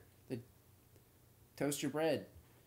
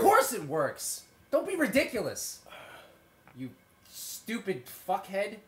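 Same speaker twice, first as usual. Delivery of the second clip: scolding shout; 4 semitones higher, 7 dB louder, slower than usual. Acted. Character scolds, acted rant.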